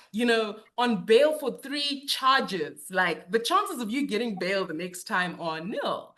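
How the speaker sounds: ordinary talk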